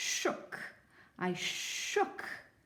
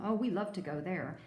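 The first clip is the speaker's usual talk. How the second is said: usual speaking voice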